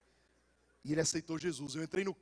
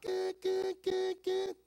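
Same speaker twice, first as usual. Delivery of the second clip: imitated radar beeps; 10 semitones higher, much slower than usual. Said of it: "ele foi falando assim".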